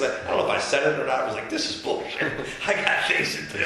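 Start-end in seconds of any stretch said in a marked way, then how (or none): 1.5-3.6 higher, fed-up mock outburst; retold anger. Walking off Don Lemon's set, a fed-up mock outburst. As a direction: toss it off like you're done with this. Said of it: "I was like, pissed".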